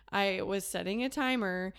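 normal talking voice

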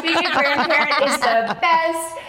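high-pitched, laughing mock triumph; gloating grandmother. Woman imitates her mom relishing payback, high and gleeful. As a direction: crow it with laughter in the voice. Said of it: "she was like".

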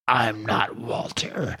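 a demonic creature, slowly and hushed; menacing monster voice. Acted. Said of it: "This is how I envision this voice".

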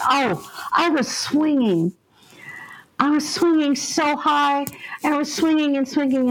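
put-on dreamy little girl. Ghost girl recalls swinging.

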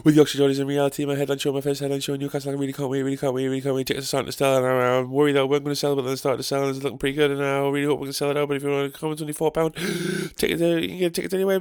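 fast, breathless gig plug; hyped-up ad for his headline show; rushed promo read. Acted. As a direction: rattle through the dates quickly. Second promo over music.

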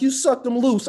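high-pitched comic blame; mock accusing. Mother blaming her son for her body, accusingly.

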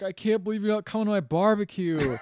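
loud, high-pitched acted indignation; mock outrage. Troy rebuffed over his barbecue invitation, mock outrage. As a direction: act out a hammed-up wounded tirade.

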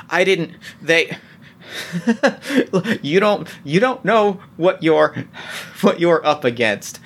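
stammering with a nervous laugh; panicked stammer. Acted. Cornered doctor warning the woman towering over him, panicked stammer. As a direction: stammer and restart, laughing uneasily as pitch jumps. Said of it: "he clearly starts to stammer".

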